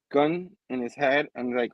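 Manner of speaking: ordinary talk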